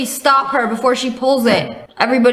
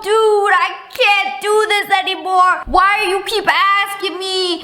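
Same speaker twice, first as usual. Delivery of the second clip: put-on higher, exasperated girlfriend voice; higher whiny exasperation; upset girlfriend; 8 semitones higher, slower than usual. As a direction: plead with a dismissive boyfriend. Girlfriend in acted quarrel.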